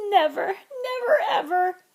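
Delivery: high, loud crying wail; wailing sob. A narrator's reading. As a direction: sob out the words. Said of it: "he wailed".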